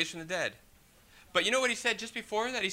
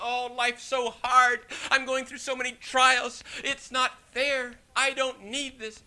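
slow, higher whining complaint; complaining believer; 5 semitones higher, 4 dB louder, slower than usual. Pretend. Preacher mimics a believer moaning about trials, slow and higher. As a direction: drag out the self-pity, pitched up. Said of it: "We feel".

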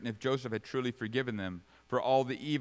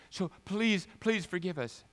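higher-pitched begging; mock pleading; 7 semitones higher than usual. Brothers begging Joseph with a made-up story, mock pleading. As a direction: plead in a raised, put-on voice. They said.

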